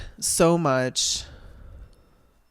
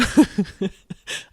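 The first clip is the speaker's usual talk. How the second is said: amused laugh; 5 semitones higher than usual. Real, not acted. Joking about falling through every crack, bursting out laughing. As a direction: burst of higher-pitched laughter. Laughs at her own joke.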